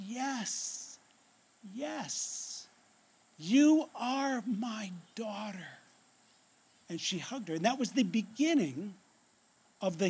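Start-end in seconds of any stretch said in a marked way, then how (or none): 0.1-5.8 hushed, slow, heartfelt affirmation; tearful tenderness. Retold or imitated. Adoptive mother holds her daughter, hushed and tearfully tender. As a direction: say it softly, drawing out each word. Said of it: "with tears running down her face".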